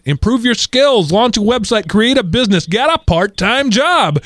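high-pitched, punchy list read from a letter; emphatic exhortation. Acted. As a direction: read out each piece of advice slowly and high. Reading a listener's list of exhortations.

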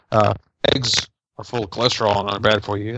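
normal talking voice

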